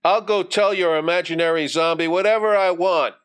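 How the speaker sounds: high, defiant sneer; sneering bully. A narrator's reading. Bully retorts to Johnny before climbing, with a sneer. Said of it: "Billy said with a sneer".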